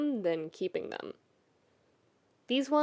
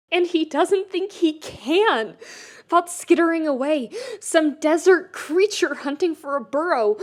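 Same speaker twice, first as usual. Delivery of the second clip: overwhelmed urgency; 6 semitones higher, 5 dB louder than usual. A narrator's reading. Keith overwhelmed.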